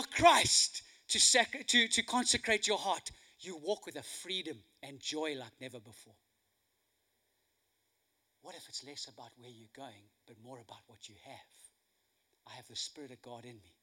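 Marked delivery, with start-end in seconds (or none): 3.4-13.7 low, very soft, intimate preacher; hushed preaching. Real, not acted. Preacher telling them what they have in Christ, hushed and reflective. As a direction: bring it right down, low and quiet.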